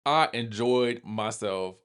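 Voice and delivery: slow, savoring "I enjoyed myself"; slow emphatic. Real, not acted. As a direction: lean into "I enjoyed myself" slowly. Repeats verdict twice.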